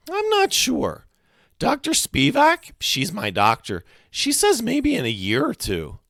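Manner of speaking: put-on very high-pitched kid voice; high, drifting boy's answer; wistful boy. A narrator's reading. Freak answers in his high voice.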